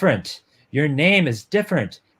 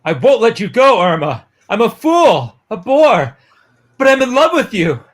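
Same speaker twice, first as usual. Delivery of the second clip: higher and louder, pleading all through; desperate plea; 6 semitones higher, 6 dB louder than usual. Acted. Gil begs Irma at her door, loud and desperate. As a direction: beg her not to go, voice raised.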